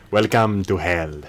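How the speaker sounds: slow, lightly voiced Skinner; Skinner impression. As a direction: drawl it like Skinner. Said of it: "the way Skinner says".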